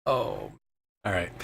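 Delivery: ordinary delivery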